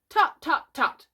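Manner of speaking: high, loud tutting; self-important, high-pitched clucking; pretend doctor. A narrator's reading. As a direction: tut like a boy playing doctor.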